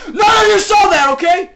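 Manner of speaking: very loud, high-pitched yelling; escalated panicky cover-up yell; mock frantic shout. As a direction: shout the warning at the top of your voice.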